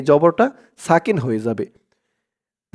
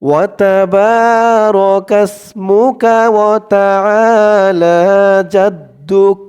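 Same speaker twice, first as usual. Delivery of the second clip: slow recitation; 3 semitones higher, 4 dB louder, much slower than usual. Shown as a demonstration. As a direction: recite it stretched for learners to follow. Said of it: "আমার সাথে খেয়াল করে বলুন". (ধীর তিলাওয়াত)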